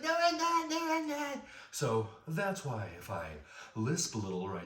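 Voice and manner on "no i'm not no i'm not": much higher, louder mushy protest; impeded boy protesting. Retold or imitated. Boy answering the bullies, high loud impeded denial. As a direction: protest through a swollen tongue. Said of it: "And I'm like".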